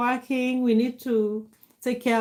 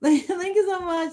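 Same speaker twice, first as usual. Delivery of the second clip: high-pitched, laughing gratitude; laughing thanks; 8 semitones higher than usual. Real, not acted. Laughing while thanking a viewer.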